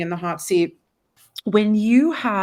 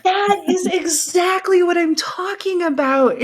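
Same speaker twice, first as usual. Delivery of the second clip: excited; 9 semitones higher than usual. Real, not acted. Excited agreement over the mug.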